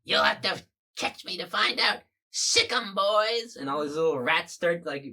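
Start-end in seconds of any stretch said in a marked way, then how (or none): 0.0-3.6 high, loud gloating villain; villain taunt. Acted. Rat monster taunts hero and sics his rats, loud and high. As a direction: cackle it out as you set the rats on him.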